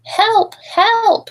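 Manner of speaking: high, loud panicked cries; screaming for help. Acted. Boy shaken by the mother elephant, screaming high and loud. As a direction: yell out in terror. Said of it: "he screamed".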